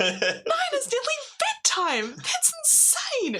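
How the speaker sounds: very high, slow outraged squeal; incredulous. Retold or imitated. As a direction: stretch it out in disbelief. Mocking outrage at late dinner.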